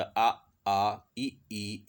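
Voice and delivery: आ and ई drawn out slowly with spread, unrounded lips; unrounded vowel demo. Shown as a demonstration. Teacher demonstrates unrounded vowels, slow and drawn out. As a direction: stretch आ, आ, ई, ई slowly, lips flat and open. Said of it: "ऐसा वृत्त नहीं बनेगा".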